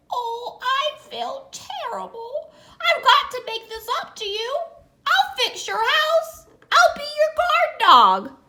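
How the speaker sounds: high eager dog offering help; sorry eager dog. Acted. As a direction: rush from regret to eagerness, high.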